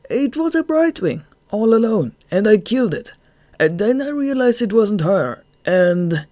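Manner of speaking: high, loud, whining excuse; miserable underling. A narrator's reading. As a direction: plead the excuse, then trail off. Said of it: "He trailed off miserably".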